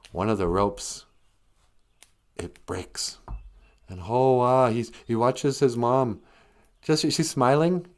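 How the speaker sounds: normal talking voice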